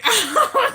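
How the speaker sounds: real high, loud laugh; burst of laughter. Real, not acted. Streamer cracking up mid-story, high loud laugh. Amused at own stuffy-nose talk.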